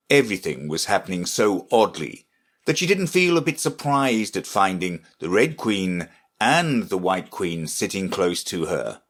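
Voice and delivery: ordinary delivery, nothing marked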